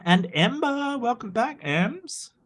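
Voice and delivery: high, bright-pitched greeting, same volume; excited welcome. Real, not acted. Streamer greeting returning chatter Ember, excitedly high. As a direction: sing out a welcome to a returning friend.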